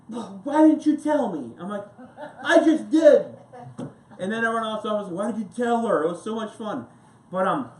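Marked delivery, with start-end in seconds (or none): 0.1-1.5 loud, much higher, slow accusing cry; indignant outburst. Retold or imitated. Embarrassed woman blames the coworker, loud and much higher. Girl got all mad. 2.4-3.4 loud, much higher retort; exasperated shout. Retold or imitated. Coworker shoots back at the blaming woman, loud and much higher. 5.1-6.9 mock complaint. Everyone else teasing.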